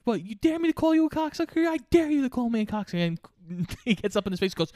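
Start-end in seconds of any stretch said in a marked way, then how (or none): 0.0-3.2 very high, rapid mock squabbling; goading argument. Player and umpire daring each other, mock argument. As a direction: rattle off a dare in a pitched-up voice.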